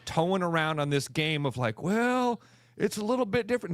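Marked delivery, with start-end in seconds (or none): 1.8-3.7 mocking whiny excuse.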